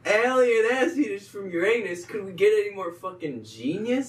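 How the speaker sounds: high, loud delighted cry; thrilled by the title idea; excited. Real, not acted. Friend cheering the movie title, loud and excited. Excited about own joke.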